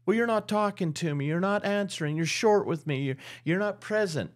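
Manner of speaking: high-pitched mock complaint; aggrieved wife. Wife explaining her suspicion to husband, mock aggrieved. Wife lists grievances in acted quarrel.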